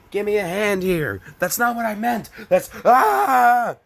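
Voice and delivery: high, loud squeaky voice acting out a bit; put-on voice. Reader doing a silly high voice for the joke. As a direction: pitch it way up and push it loud.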